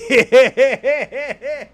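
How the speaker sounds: real hearty laugh; loud laugh. Real, not acted. Laughs at own joke.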